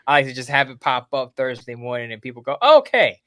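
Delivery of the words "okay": high, loud mock excitement; excited reader reaction. Columnist imagining readers discovering his morning post, excited. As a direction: exclaim it like a keen, surprised fan.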